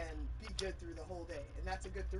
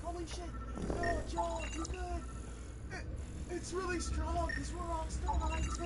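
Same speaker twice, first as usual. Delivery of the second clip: higher, amazed friends' voice; buddies' reaction; 11 semitones higher, slower than usual. Retold or imitated. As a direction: answer high and amazed. Retold buddies on phone.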